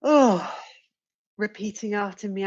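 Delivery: normal talking voice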